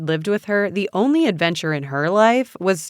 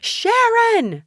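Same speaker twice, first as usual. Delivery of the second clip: very high, desperate shout; piercingly high call of a name; 5 dB louder than usual. A narrator's reading. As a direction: shout past someone blocking you. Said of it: "I shouted".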